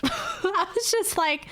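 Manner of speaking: breathless laughing, high and slow. Real, not acted. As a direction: laugh through the words.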